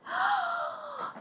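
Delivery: soft, very high drawn-out "oh"; amazed gasp. Real, not acted. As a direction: gasp in delighted wonder. Amazed on discovering the item. (oh très aigu, émerveillé)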